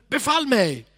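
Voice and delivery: eager command. Retold or imitated. Peter urging Jesus, loud and eager. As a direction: call it out eagerly. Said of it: "Han säger".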